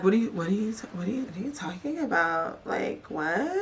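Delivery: soft, halting, mock confusion; playing dumb. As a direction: stammer it softly as if clueless. Acting out people playing dumb.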